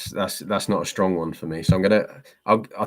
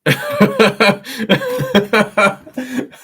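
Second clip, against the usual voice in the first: long, high, loud burst of laughter; laughing; 8 dB louder than usual. Real, not acted. Reacting to viewers' requests.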